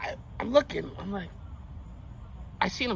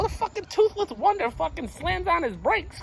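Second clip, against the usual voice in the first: ordinary talk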